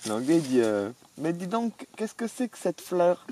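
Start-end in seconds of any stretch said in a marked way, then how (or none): 1.2-3.3 mild mock English accent in French, a touch higher and slow. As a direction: lightly put on an Englishman speaking French. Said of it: "C'est un Anglais, c'est un Anglais".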